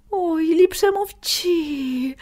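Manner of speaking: high, very slow, pained sigh; girl close to crying over kittens; tearful plea. Acted. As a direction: near tears, begging him to stop. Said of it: "гірко схлипнула".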